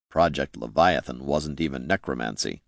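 quiet, low-pitched rebuttal; Jin. A narrator's reading. Jin calmly counters the accusation.

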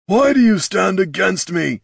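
high, loud, hurt challenge; orc chieftain. A narrator's reading. Chieftain challenging his sister, loud and high. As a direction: confront her, stung. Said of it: "said Darek".

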